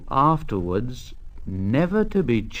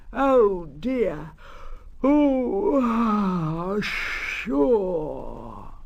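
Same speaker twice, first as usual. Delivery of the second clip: flustered engine; 6 semitones higher, much slower than usual. Acted. Thomas wakes up late.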